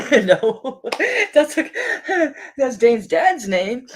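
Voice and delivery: real laughter breaking up the words; laughing. Real, not acted. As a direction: talk through a high, loud laugh. Laughing at a wrong guess.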